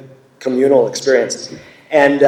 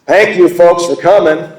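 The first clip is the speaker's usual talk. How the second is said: raised-pitch, loud stage greeting; 6 semitones higher, 7 dB louder, slower than usual. Retold or imitated. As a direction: imitate an old crab of a singer addressing the crowd. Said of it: "such an old crab when he talks".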